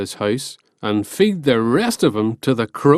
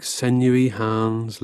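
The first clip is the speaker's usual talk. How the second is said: usual speaking voice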